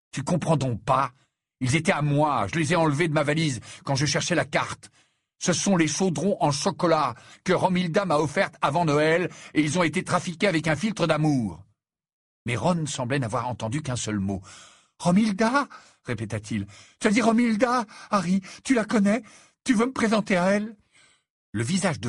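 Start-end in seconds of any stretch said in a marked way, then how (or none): 0.1-11.6 loud, urgent, higher explanation; exasperated explaining. A narrator's reading. Harry explains the love potion to Ron, exasperated. 15.0-15.7 high, loud, thrilled question; lovestruck excitement. A narrator's reading. Ron hears his beloved's name, thrilled. 17.0-20.7 high, loud, eager pleading; lovestruck excitement. A narrator's reading. Ron begs Harry for an introduction, giddy with excitement.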